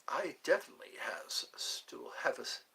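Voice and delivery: unvoiced whisper of the chat line. Real, not acted. Whispering a chat message to viewers. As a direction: breathe it out softly.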